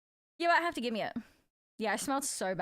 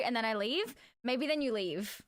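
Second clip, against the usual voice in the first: ordinary delivery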